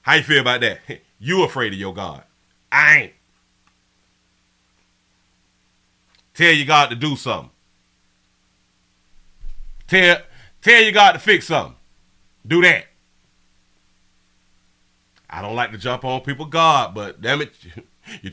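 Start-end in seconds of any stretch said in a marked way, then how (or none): 2.7-12.9 loud, fast taunt; defiant challenge. Real, not acted. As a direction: push back hard at the chat. Defiant reply to chatter.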